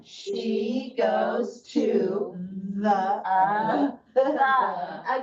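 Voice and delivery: very slow "She goes to the", each word stretched; slow choral reading. Shown as a demonstration. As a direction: drag each word for a class to echo. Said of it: "so it's gonna go like this".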